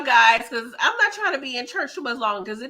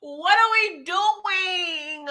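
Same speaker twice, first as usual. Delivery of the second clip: very slow, raised, drawn-out complaint; exasperated wail; 5 semitones higher, much slower than usual. Real, not acted. Host baffled by the bad directing, drawn-out exasperated wail. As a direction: stretch it into a comic wail of disbelief.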